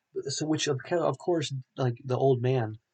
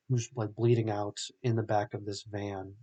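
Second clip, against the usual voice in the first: ordinary delivery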